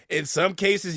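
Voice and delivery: ordinary delivery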